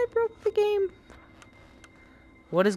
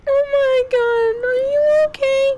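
shocked concern; 4 dB louder than usual. Real, not acted. Checking on hurt character.

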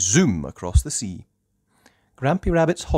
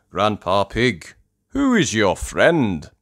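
Mister Stallion; 2 semitones higher, 6 dB louder than usual. Acted. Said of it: "asks Mister Stallion".

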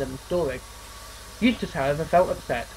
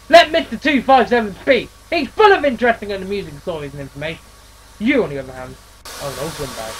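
telling off; 3 semitones higher, 5 dB louder than usual. Acted. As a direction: put him in his place. Engine tells Eustace off.

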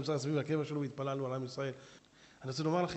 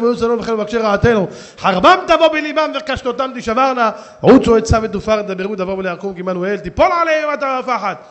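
very loud, higher intoned blessing; closing blessing chanted to the congregation; fervent prayer; 5 semitones higher, 17 dB louder than usual. Acted. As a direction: preach at full force.

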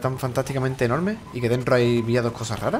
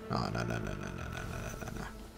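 string of no's sinking lower and softer; fading no's; 13 semitones lower, 8 dB quieter, slower than usual. Acted. Player watching things go wrong, mock despair fading out. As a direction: let the no's die away slowly to nothing. Losing, dramatic fading denial.

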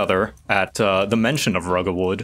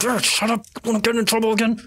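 high-voiced minion hushing his partner; urgent, pitched-up telling-off; scolding henchman; 11 semitones higher, much faster than usual. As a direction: scold a companion without raising volume.

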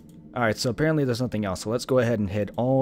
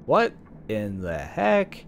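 ordinary delivery

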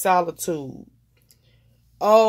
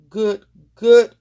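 good, good punched higher and louder; emphatic; 4 semitones higher, 10 dB louder than usual. Real, not acted. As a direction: hammer home "good, good". Emphatic praise of the technique.

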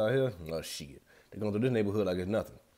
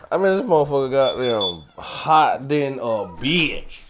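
slow, louder drag of the heat complaint; drawn-out complaint; 3 semitones higher, 7 dB louder, slower than usual. Real, not acted. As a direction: stretch every word out like the heat. Repeats the complaint more drawn out.

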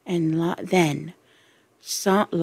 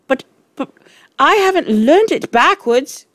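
high, protesting stammer; stumble, then plead in a high voice; 10 semitones higher, 6 dB louder than usual. A narrator's reading. Wilfred protesting to the Trunchbull, stammering. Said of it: "stammered Wilfred".

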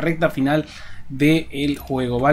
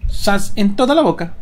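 taunting; 5 semitones higher, 4 dB louder, slower than usual. Acted. Taunting enemy after a kill.

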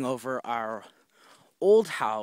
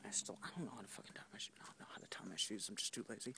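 whispered aside; 4 semitones lower, 18 dB quieter, much faster than usual. Real, not acted. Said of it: "quiet aside".

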